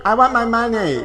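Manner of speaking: high shouted picket chant; screaming for my money at the strike; mock strike scream. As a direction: yell it like a striker at a picket line. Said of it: "I'm gonna scream out".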